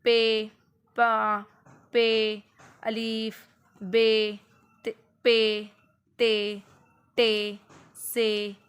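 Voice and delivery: teacher pronouncing letters clearly; clear open pronunciation. Shown as a demonstration. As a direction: open the mouth on every letter. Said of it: "खुले तरीक़े से वाज़ेह तौर पर".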